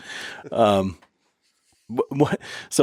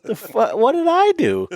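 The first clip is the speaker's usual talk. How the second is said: baffled; 13 semitones higher than usual. Real, not acted.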